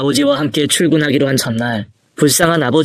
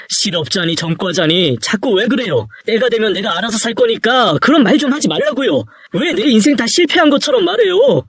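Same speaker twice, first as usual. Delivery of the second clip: defiant son, pitch climbing high; hurt, angry outburst that keeps rising; indignant protest; 6 semitones higher than usual. Acted. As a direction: argue back with mounting frustration. Son argues back at father.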